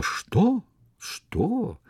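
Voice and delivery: high-pitched startled "what?"; astonished exclamation. A narrator's reading. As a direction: exclaim as if stung by wasps. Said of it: "воскликнули одновременно, словно их, сговорившись, ужалили осы".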